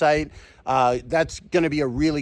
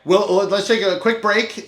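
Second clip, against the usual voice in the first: slow, higher and louder show-host announcement; lightly hammed host voice; 8 semitones higher, 6 dB louder than usual.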